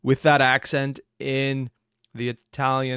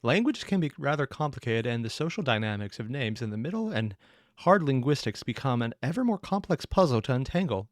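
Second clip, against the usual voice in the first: ordinary delivery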